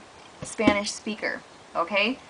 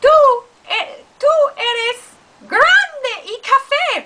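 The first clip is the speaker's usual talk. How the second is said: much higher, louder, slow cartoon cat; squeaky cat; 14 semitones higher, 7 dB louder, much slower than usual. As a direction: shriek it at the dog. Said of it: "this is what it would sound like".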